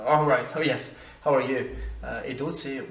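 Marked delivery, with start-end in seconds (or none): 0.1-1.6 a louder, lightly acted English greeting; acted hearty greeting. Traveller adapts to accent in seconds, hearty greeting. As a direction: greet them heartily, as if you just caught on.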